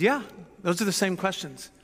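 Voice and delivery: put-on light German accent on Einstein's words. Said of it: "so I'm doing my German accent".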